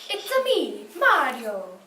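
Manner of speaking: put-on high, loud Italian accent; Mario. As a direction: shout it like a cheerful plumber. Kid imitates game hero.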